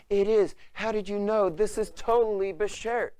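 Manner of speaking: high, loud, gushing disbelief; amazed lovestruck boy. Acted. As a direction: squeal it like a smitten boy. Amazed lovestruck boy in retold exchange.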